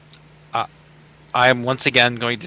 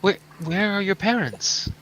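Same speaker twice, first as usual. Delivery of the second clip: gentle; 7 semitones higher than usual. Acted. Adventurer asking the lost girl about herself, gentle. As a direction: ask kindly so she is not scared.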